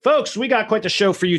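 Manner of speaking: podcast host opener. Acted. Host greeting his podcast listeners, pumped-up and bright. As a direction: hype up the listeners like a radio host.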